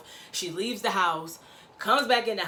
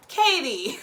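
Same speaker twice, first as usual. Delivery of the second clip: high, loud disbelief; incredulous exclamation; 6 semitones higher, 5 dB louder than usual. Real, not acted. Reacting to Katie's excuse, high and incredulous. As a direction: call her name in disbelief. Speaker's own exasperated reaction.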